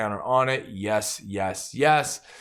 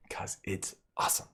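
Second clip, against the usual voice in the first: near-whisper, much softer; hushed praise; 5 semitones lower, 12 dB quieter than usual. Real, not acted. Host recommends buying the card, hushed whisper. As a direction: drop to an awed whisper.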